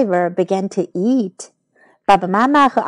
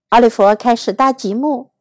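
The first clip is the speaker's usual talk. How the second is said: usual speaking voice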